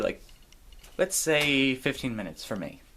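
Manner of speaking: ordinary talk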